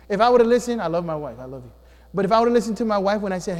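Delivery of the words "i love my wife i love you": ordinary talk, nothing marked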